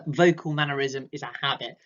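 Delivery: ordinary talk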